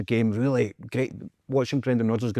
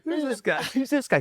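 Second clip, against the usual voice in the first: high put-on voice, laughing through it; mocking journalists; 10 semitones higher than usual. Retelling the journalists' confusion to the co-host, high mock voice. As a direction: ham up their confusion.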